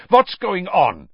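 high, loud demanding cry; loud, high outburst; alarmed cry. A narrator's reading. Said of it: "he cried".

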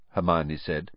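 ordinary delivery

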